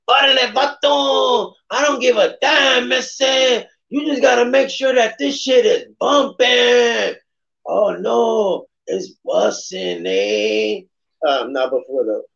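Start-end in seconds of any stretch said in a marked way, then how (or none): 0.1-10.8 high, shouty mock Chicano homeboy; West Side voice. Hyping a friend's track in his West Side voice, high and shouty. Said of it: "my West Side voice".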